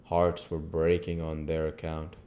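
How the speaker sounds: plain reading voice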